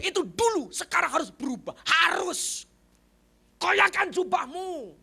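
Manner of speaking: loud, high preaching; forceful exhortation. Real, not acted. Preacher demanding that listeners change, forceful shouting. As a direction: drive each command home.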